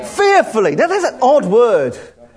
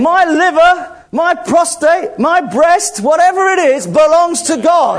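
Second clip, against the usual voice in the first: raised, emphatic preacher's declaration; forceful preaching; 5 semitones higher, 3 dB louder than usual. Real, not acted. Preacher claiming the body for God, forceful. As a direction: declare each item firmly, with rising intensity.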